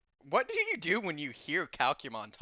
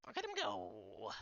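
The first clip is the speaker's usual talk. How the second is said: sleepy mumble; 3 semitones lower, 9 dB quieter than usual. Acted. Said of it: "Sable just hears".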